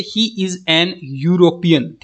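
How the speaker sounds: slow, careful example sentence; slow teaching demo. Shown as a demonstration. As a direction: spell the example out slowly for students. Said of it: "देखो ई से उसके spelling शुरू हो रहे हैं".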